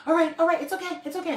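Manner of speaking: high-pitched acted self-calming; flustered reassurance. Little elf settling down after panic, flustered.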